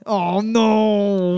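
mock French accent on a drawn-out no. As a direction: stretch the word in a put-on French accent. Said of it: "That's the only French accent I can do is just the word no".

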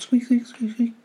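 high squeaky squeak squeak; high mouse squeaking. Acted.

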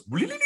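high trilled funhouse noise; imitated skeleton gag. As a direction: mimic the skeleton popping up, high and rattly. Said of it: "It pops up there and goes".

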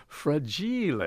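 high, soft mock Italian; Christmas Story fra-jee-lay. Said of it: "going back to the Christmas Story".

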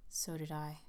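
softly voiced, a touch of nerves; low, nervous. A narrator's reading. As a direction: answer in an uneasy undertone. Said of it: "answered Gabriel in a low, nervous voice".

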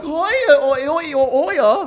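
loud, high-pitched Danish nonsense; hammed-up Danish yodel-like calls, way up high; mock Danish accent. Imitating Danish women for friends, loud high mock Danish. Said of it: "they're like".

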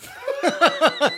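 high, loud burst of laughter; uncontrolled laugh. Real, not acted. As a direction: crack up at your own joke. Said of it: "I don't know why I'm laughing".

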